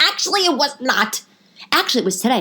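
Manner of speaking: high, pointed contradiction; acted-out argumentative comeback; indignant correction. Contradicting boyfriend in retold quarrel.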